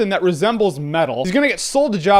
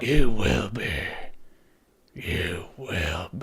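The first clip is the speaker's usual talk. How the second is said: much lower, slow creepy Yoda voice fading into a murmur; 14 semitones lower, 8 dB quieter, much slower than usual. Retold or imitated. Yoda warning Luke, slow eerie low murmur.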